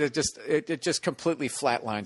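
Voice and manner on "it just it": normal talking voice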